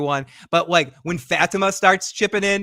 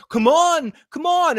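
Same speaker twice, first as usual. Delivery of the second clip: much higher, louder impatient pleading; impatient urging; 11 semitones higher, 5 dB louder, much slower than usual. Retold or imitated. Fatima pushing the group, high impatient urging. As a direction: urge them on, exasperated. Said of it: "she's like".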